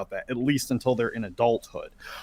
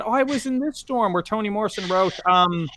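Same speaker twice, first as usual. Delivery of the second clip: lightly voiced, higher ditzy Kamala who has lost her train of thought; mock Kamala Harris; 9 semitones higher than usual. Host mocking Kamala Harris fumbling a book title, higher mock ditzy voice.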